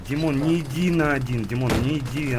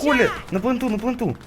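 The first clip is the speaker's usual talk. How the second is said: urgent callout; 9 semitones higher than usual. Real, not acted. Calling teammate to the plant.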